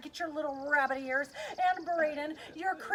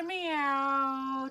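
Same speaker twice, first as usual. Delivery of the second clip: loud, slowly stretched meow; cat meow; 6 dB louder than usual. Pretend. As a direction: draw out a playful cat cry. Acting a jungle cat.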